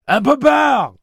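high, loud shout; yelling. A narrator's reading. Eddie insisting to Roland it's a lie, yelling. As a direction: yell it out hard. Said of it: "hurla Eddie".

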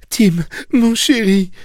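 high, tender injured mother. Acted. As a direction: reach out to him lovingly. (mère tendre, voix aiguë)

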